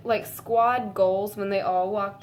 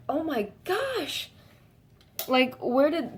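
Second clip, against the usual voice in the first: high, slow squealing gush; squealy gush; 5 semitones higher, slower than usual. Shown as a demonstration. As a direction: gush it like a starstruck fan. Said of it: "that's better".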